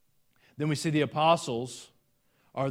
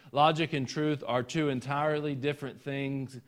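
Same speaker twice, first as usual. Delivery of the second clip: usual speaking voice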